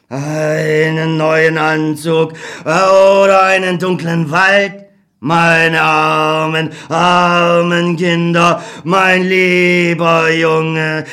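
sorrowful, can do nothing; helpless old God. Acted. God apologises to Beckmann, slow and grieving.

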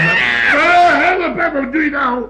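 high, loud panicked cry; startled shriek. Acted. Startled man crying out when she screams, high and loud. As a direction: shriek for help in fright. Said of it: "Nou, ik gil".

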